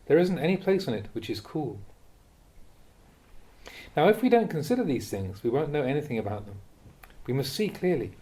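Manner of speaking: ordinary delivery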